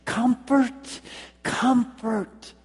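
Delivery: "Comfort, comfort" pitched high, each word set apart; emphatic repetition. Acted. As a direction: call out "Comfort", pause, then "comfort" for attention. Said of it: "get their attention and emphasize".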